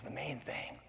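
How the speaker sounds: very quiet, musing to himself; puzzled echo. Acted. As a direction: repeat it softly, puzzled. Man puzzles over flower's words.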